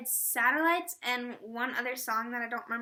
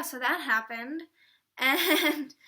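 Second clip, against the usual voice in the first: normal talking voice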